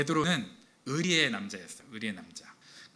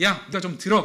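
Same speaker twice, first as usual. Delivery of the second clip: higher, louder mock older brother order; louder mock order to a younger brother; bossy big brother; 6 semitones higher, 9 dB louder than usual. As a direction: push the chore onto the sibling. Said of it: "이제 동생한테".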